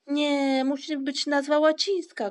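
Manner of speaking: high, loud insistent objection; shouting. A narrator's reading. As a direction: shout your objection over the others. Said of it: "krzyczał Paszczak".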